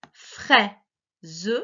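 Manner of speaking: "Fraise" in two loud beats, frai-se; "Fraise" divided frai / se with a gap; split into syllables. Shown as a demonstration. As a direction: sound out "Fraise" with a voiced final e. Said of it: "la première syllabe de chaque mot".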